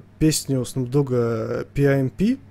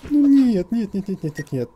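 scared horror game streamer; 7 semitones higher, 5 dB louder than usual. Real, not acted. Monster appears in horror game.

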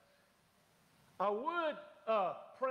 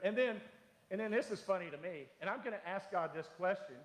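ordinary talk

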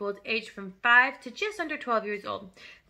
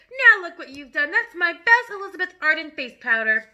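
loud, high-pitched scolding; shrieking mother; 5 semitones higher, 7 dB louder than usual. Acted. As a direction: wail over the spilled powder. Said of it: "shrieked the mother".

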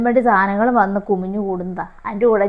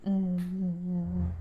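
soft, low hums to settle an infant; soft humming to a baby; 3 semitones lower, 11 dB quieter than usual. Acted. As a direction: hum the baby to sleep under your breath. Soothing baby.

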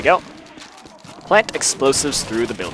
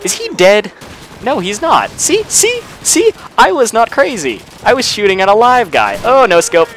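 high, triumphant and much louder; excited; 10 semitones higher, 5 dB louder than usual. Real, not acted. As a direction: crow with delight, pitch way up. Vindicated after hitting enemy.

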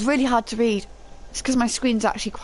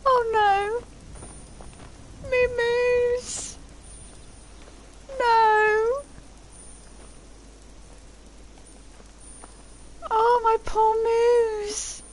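much higher, whiny grieving voice; sad whimper; much slower than usual. Real, not acted. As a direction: mourn the poor moose. Mourning the dead companion.

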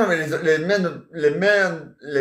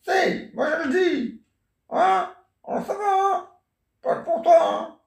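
much higher put-on wife voice; grandma playing the wife on a pretend phone call, voice pitched way up; 12 semitones higher than usual. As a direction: play the wife calling her husband on the phone, high and sweet.

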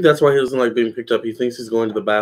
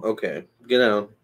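ordinary talk, nothing marked

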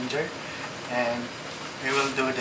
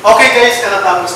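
calling out; 6 semitones higher, 10 dB louder than usual. Real, not acted. Host calls out to viewers.

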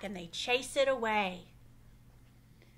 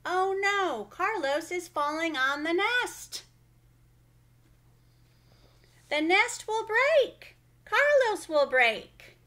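alarmed; 7 semitones higher, 6 dB louder than usual. A narrator's reading. Carlos falling into nest, alarm.

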